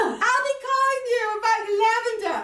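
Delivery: a saleswoman from the Bronx calling across the room; Bronx accent. Retold or imitated. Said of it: "She sounds like she's from the Bronx".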